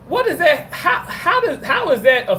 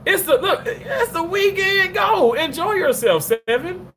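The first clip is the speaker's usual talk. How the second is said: excited urging; 11 semitones higher than usual. Real, not acted. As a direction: cheer him on to take the trip. Urging a viewer to travel.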